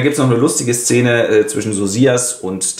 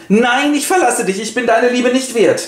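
high, dramatic wife's voice; Alkmene voice; 11 semitones higher than usual. Acted. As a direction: throw yourself into mock despair, pitched high. Alkmene despairs before Jupiter. (hohe, theatralisch verzweifelte Frauenstimme)

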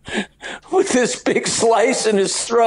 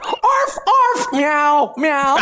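high barks, then meows; mock dog and cat calls; animal noises; 4 semitones higher, much slower than usual. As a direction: yap like a little dog, then mew. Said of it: "What do the gerbils say".

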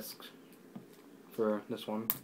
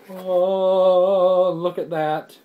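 a high, loud burst of delight, then settling; real thrill on seeing the box; delighted gasp; 7 semitones higher, 11 dB louder, much slower than usual. Real, not acted. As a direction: gasp at a treasure you just unwrapped. Delighted unboxing his favourite show.

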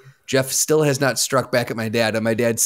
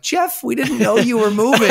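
high, louder mock-parent protest; upset parents; 10 semitones higher, 3 dB louder than usual. Parents calling their son about his move, mock upset. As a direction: imitate baffled parents on the phone.

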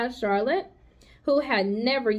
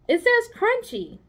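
Charlotte's voice; 12 semitones higher than usual. Acted. Charlotte reads the ad.

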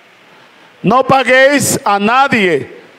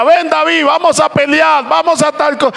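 Saul's challenge; 8 semitones higher than usual. Retold or imitated. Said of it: "Y Saúl le decía".

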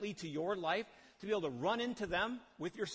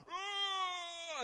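mock scream. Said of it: "it screams at you".

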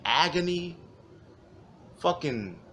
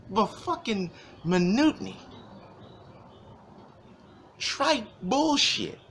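exasperated retort; 8 semitones higher, 3 dB louder than usual. Retold or imitated. Exasperated reply to customer.

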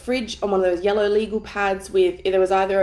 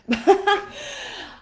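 bright laugh; 12 semitones higher, 3 dB louder than usual. Real, not acted. Bursts out laughing mid-intro.

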